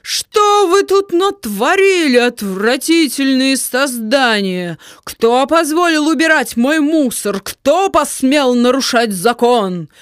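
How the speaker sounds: slow, booming evil witch; thunderous terrible voice. Acted. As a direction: loom over the children and threaten. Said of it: "страшный громовой голос Неуберинды".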